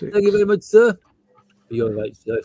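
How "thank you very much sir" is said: barking soldier thanks, pitched up; eager grunt shouting his thanks; loud gung-ho soldier. Acted. Soldier character thanks superior.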